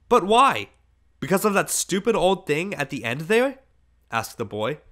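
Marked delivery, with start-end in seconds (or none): 0.1-3.6 loud, high, indignant questions; scornful protest. A narrator's reading. Boy to his girl about the old woman, scornful protest. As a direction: protest loudly, pitched up with contempt.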